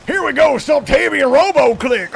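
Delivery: drill sergeant voice. Acted. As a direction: shout orders like a boot camp sergeant. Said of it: "you don't like my drill sergeant voice".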